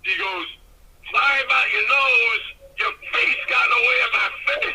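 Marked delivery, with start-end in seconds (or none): 1.1-4.7 loud, high imitation of a wrestler; booming mock apology. Wrestler phoning about the broken nose, booming mock apology. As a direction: holler the apology like a big hearty wrestler.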